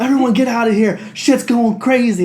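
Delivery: loud, high panicked shouting; mock panic. Doctors starting a panic in the atrium, mock panicked shout. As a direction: holler a hammed-up evacuation alarm.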